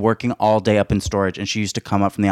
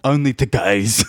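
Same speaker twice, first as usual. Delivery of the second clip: deep, slow British drawl; comic deep-voiced answer, drawn out; slower than usual. Singer answering the interviewer, deep voice. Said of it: "do it in a deep voice".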